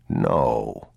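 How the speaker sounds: low, firm denial; reassuring father. A narrator's reading. Father answering his frightened son, low reassurance.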